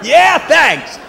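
high, loud call to the crowd; loud thanks. Real, not acted. Comic thanking the audience, shouted.

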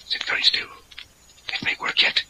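tense whisper; hushed waiting. Acted. Leader to partner waiting for the ritual to work, whispering. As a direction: whisper so as not to break the spell.

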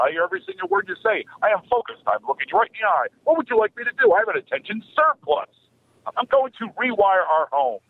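high, insistent mock child voice; over-attentive kid. Kid comes up to parent, mock-intense focus. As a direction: pitch it way up, earnestly declaring each line.

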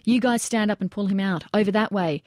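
ordinary delivery, nothing marked

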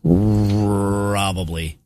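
very slow, stretched-out "Probably"; long, lingering delivery of one word; drawn-out. Real, not acted. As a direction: drag the word right out. Drawn-out answer after reading.

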